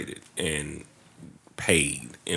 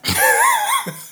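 burst of laughter; 6 dB louder than usual. Real, not acted. Mock mafia mansion fantasy.

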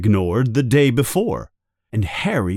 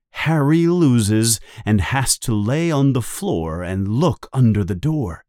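ordinary delivery

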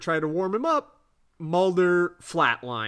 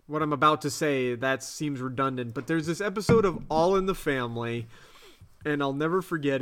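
ordinary delivery

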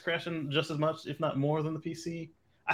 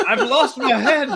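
laughing; 11 semitones higher, 10 dB louder than usual. Real, not acted. Joking about glowing head.

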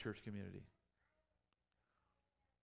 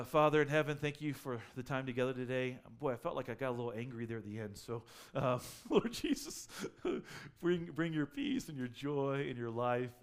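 louder prayer, breaking into laughter; closing prayer; 3 semitones higher, 8 dB louder than usual. Real, not acted. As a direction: pray it out with a laugh.

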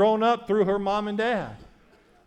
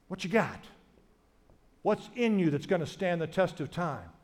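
ordinary talk

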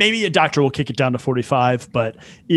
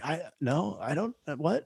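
much softer, faltering mock confusion; flustered stammer; 11 dB quieter than usual. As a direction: stammer it quietly, at a loss. Retold flustered reply to the doctor.

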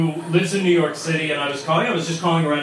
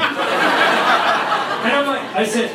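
ordinary delivery

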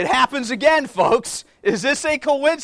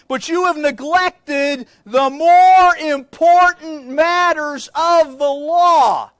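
slow, loud, high shouted rebuke; preacher shouting Jesus' rebuke at the congregation; preaching shout; 4 semitones higher, 7 dB louder, slower than usual. Acted. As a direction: hammer each word out, slow and loud. Preacher shouts scripture climax.

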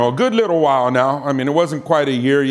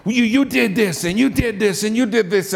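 higher-pitched volley of accusations; mock accusation; 6 semitones higher than usual. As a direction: fire them off as an accusing caller. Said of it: "questions were coming at me".

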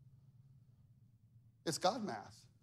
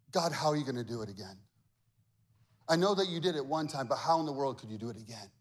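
lower, fast fretful questions; quick doubting whine; 7 semitones lower, much faster than usual. Retold or imitated. Humans anxiously questioning God again, fast and low. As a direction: rattle off the worry.

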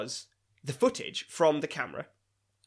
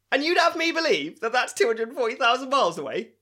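high, loud retold disbelief; mock incredulous; 11 semitones higher, 7 dB louder than usual. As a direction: imitate an outraged skeptic, pitch raised. Acting the video maker's disbelief.

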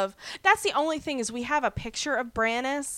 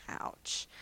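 whispered ouch; 15 dB quieter than usual. Real, not acted.

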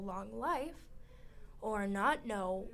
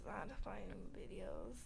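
whisper; 8 dB quieter than usual. Pretend. Continues ASMR teasing.

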